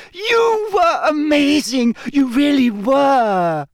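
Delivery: high, drawn-out gushing squeal; squeaky. A narrator's reading. Hermione thrilled after watching Harry in fear, squeaky. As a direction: gush it very high and slow. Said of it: "Hermione said squeakily".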